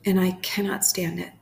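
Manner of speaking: unhurried prayer with long pauses between phrases; guided prayer meditation. Acted. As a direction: pray it phrase by phrase, leaving room to repeat.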